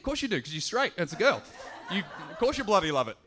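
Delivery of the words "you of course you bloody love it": quick, laughing self-mockery; mock yourself, cracking up; laughing at himself. Real, not acted.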